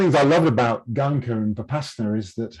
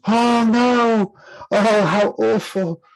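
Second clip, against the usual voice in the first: much higher and louder, slow hammed-up dismay; mock-horrified crowd; 12 semitones higher, 7 dB louder, much slower than usual. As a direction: ham up the rocklings' horror. Crowd reacts to the fall.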